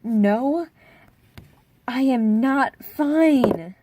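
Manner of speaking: loud, very slow, dragged-out protest; insistent refusal. Retold or imitated. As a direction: stretch every word in protest. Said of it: "And I'm like".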